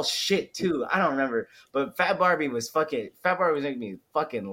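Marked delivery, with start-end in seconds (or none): none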